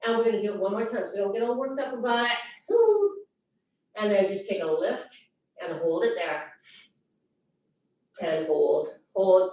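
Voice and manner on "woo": short, high, loud woo. Real, not acted. Instructor between repetitions telling class not to get worked up, high woo. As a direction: let out a quick high-pitched whoop. Exertion cheer mid exercise.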